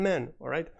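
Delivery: ordinary delivery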